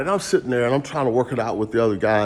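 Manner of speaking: ordinary talk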